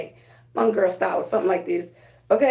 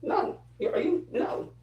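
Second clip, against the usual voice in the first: soft, higher, hushed voice of the divine; a gentle higher hush, as if spirit is speaking; 5 semitones higher, 8 dB quieter, slower than usual. Pretend. The divine telling her no, in a soft high hush. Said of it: "the divine is like".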